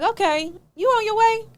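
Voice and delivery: high, loud mock-sweet question; sarcastic high. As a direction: ask with sarcastic brightness. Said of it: "I'm like".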